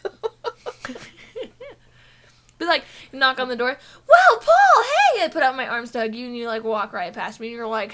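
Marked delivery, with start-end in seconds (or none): 4.1-5.2 very loud, high excited hello; gushing greeting. Pretend. Co-host pretending to greet a guest for a hug, gushing. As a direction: burst out with an over-the-top welcome.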